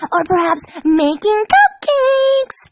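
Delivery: high, slow, sing-song Pinkie; happy announcement. A narrator's reading. Pinkie announcing the plan to Dash, high and happy. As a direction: announce it sweetly like a treat. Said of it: "Pinkie Pie happily announced".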